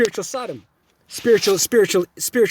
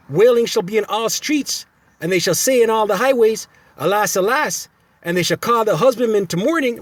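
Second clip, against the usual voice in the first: preaching scripture; 4 semitones higher than usual. Acted. Reading scripture again.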